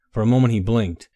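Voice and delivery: usual speaking voice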